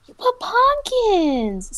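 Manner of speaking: high, loud, stretched-out exclamation; startled shout. Real, not acted. Player discovering pumpkins after a jump down, shouting. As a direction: cry out in drawn-out alarm.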